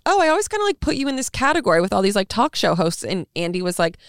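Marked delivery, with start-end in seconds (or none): 0.1-3.0 high, fast, fawning voice; gushing paparazzi. Retold or imitated. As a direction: gush up high like a fan. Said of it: "he was like".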